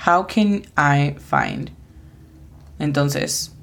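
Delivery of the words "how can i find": "How can I find?" said slowly, "can" stressed; slow careful model. Shown as a demonstration. Teacher modelling the phrase slowly after spelling. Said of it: "la pronunciación fonética de esta frase".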